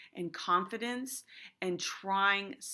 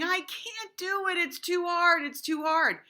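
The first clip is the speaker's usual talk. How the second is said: much higher, loud whiny wail; student whining that the task is too hard; whiny complaint; 10 semitones higher, 4 dB louder than usual. Retold or imitated. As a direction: whine like a kid stuck on snow gear. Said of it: "not in a whiny voice".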